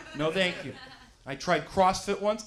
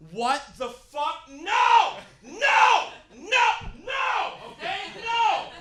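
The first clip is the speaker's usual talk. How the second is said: high, loud shouts of refusal; outraged yelling; 13 semitones higher, 8 dB louder, much slower than usual. Acted. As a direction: yell it like a diet tantrum, much higher. Mock outrage at diet rules.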